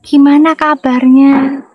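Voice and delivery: slow, drawn-out Javanese politeness; mock-polite Javanese lilt; Central Javanese manner. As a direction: imitate a refined Central Javanese speaker, stretching the words. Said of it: "Dapatnya orang Jawa Tengah lagi misalnya".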